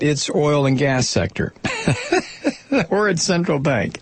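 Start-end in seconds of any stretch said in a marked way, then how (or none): 1.6-2.9 a sudden high squeal of a laugh. Real, not acted. Laughing at oil and gas being spared, high burst of laughter. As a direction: laugh out at the irony, pitched way up.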